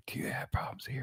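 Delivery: hushed whisper, barely audible; quick, voiceless mock whisper. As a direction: whisper it like a sly radio ad. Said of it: "They should be whispering".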